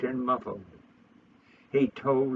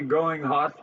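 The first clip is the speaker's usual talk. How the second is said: plain reading voice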